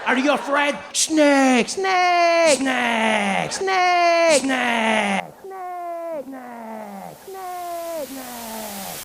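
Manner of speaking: high, long-drawn cries of snake, dying away; hammed-up French tourists. French father and son cheering at a snake, hammed-up high cries.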